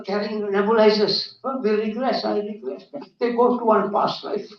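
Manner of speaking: usual speaking voice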